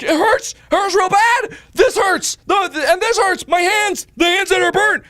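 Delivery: very high, loud mock agony; agonized yelling. Patient squeezed by blood pressure cuff, high agonized yelling. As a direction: scream complaints through the pain, hammed up.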